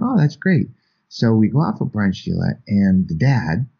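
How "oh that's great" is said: much higher, eager little reply. Retold or imitated. Answering a friend's brunch invite, much higher eager reply. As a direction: pitch it up like welcome news. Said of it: "And I went".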